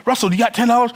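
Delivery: hurried Australian request; lightly done Australian accent. Retold or imitated. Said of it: "my Australian accent's not that good".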